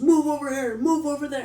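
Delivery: high, loud, clipped commands; barking orders. Retold or imitated. Guard ordering the prisoner around, high loud barking. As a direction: bark each order at him. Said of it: "barking orders at the guy".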